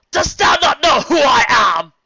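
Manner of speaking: high, loud, slow furious roar; furious shout. Acted. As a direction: roar it at the insolent guard. Celestia furious at guard.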